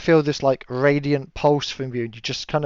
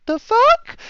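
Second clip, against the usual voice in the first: very high, squeaky "the fuck?"; shocked disbelief; 7 dB louder than usual. Acted. GM voicing Ara hit by the bear claw, very high and loud. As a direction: yelp in outraged confusion. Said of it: "you're like".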